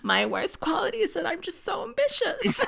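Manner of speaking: smug mock Lisa bragging; Lisa impression. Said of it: "I can't do her voice".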